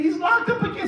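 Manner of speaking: very high hammed-up distraught mother; mock crying wail. Mother lamenting to the preacher about her son, mock crying wail. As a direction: burst out in pretend wailing. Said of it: "So she's crying".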